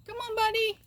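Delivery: high, loud coaxing; calling a pet. Real, not acted. Owner calling buddy to come, high calling. Urging pet to run over.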